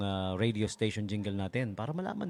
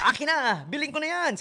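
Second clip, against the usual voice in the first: quoting a customer; 14 semitones higher, 4 dB louder than usual. Retold or imitated.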